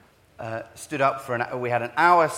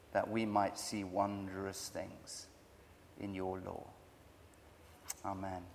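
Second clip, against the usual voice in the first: prayer; 2 semitones lower than usual. Real, not acted. Preacher praying for help before he preaches, hushed.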